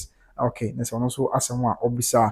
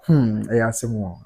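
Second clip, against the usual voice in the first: slow, low, resigned musing; slow sigh; 4 semitones lower, slower than usual. Real, not acted. Host mulls a listener comment in a slow sigh. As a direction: drag it out with a heavy hmm.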